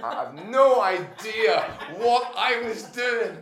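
loud, high, slow laughing confession; laughing hard. Real, not acted. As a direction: laugh right through the words at full volume. Helpless laughter at own story.

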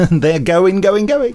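fast auctioneer patter. Pretend. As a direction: call it like an auction. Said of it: "Sound like an auctioneer then".

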